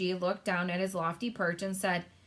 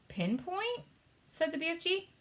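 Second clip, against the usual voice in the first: squeaky bewildered echo of a strange word; high puzzled echo of pinpoint; baffled echo; 10 semitones higher than usual. Acted. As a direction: toss the word back, utterly lost.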